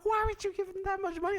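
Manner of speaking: high, fast imitated reproach; mock incredulous scolding. Date scolding him over huge tip, imitated high incredulity. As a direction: fire off a squeaky, disbelieving question.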